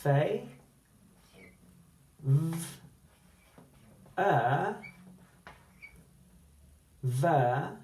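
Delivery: each sound of favour split apart. Shown as a demonstration. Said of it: "listen carefully to the last syllable".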